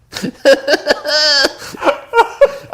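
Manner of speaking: high-pitched cackling laugh. Real, not acted. Friend's joke sets him off, high cackling laugh. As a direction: burst into squealing laughter.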